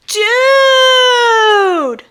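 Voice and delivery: high, loud stretched-out dude; excited exclaim. Acted. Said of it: "Lucius exclaimed".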